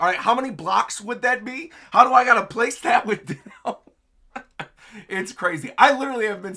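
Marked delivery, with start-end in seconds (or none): 0.0-3.8 higher, louder, laughing through the quote; laughing mock question. Gamer laughing as he imagines building it. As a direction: giggle while asking. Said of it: "I'm like".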